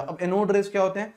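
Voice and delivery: usual speaking voice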